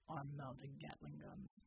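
low, slow, matter-of-fact soldier; Bulwark's deep voice. Acted. Bulwark correcting Red about the armor, low and slow. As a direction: state it flatly, deep and unhurried.

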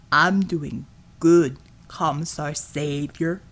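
low, very slow lumbering voice; slow and heavy like a big lug; slow deep brute voice. A narrator's reading. Chug voiced in reading.

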